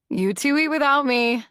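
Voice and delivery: put-on teen girl voice. A narrator's reading. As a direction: toss it off lightly and loudly. Jupiter voiced by narrator.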